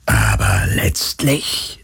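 low, slow whispery ghost; unconcerned phantom. A narrator's reading. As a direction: speak at leisure as an eerie spirit. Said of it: "sagte das Phantom unbekümmert". (unbekümmerte, tiefe Phantomstimme)

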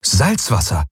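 mildly astonished one-word exclamation; baffled. A narrator's reading. Pilot reads the scanner, baffled. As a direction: let amazement lift the word. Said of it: "sagte er verblüfft".

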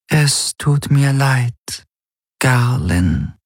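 high, slow, mournful voice in his head; a regretful spirit voice, drawn out; sorrowful inner voice. A narrator's reading. Shadow helix speaks in his head.

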